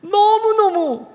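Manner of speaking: high, pleading protest to God, pitch climbing; protesting nun. Retold or imitated. Nun from the book protesting to God, high and anguished. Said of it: "하나님께 막 따졌대요".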